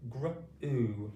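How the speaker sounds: "gr" blended, then "u" on its own; blended sounding out. Shown as a demonstration. Teacher shows the gr blend to pupils, segmented. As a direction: say "gr" quickly, pause, then "u". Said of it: "practice our pronunciation together".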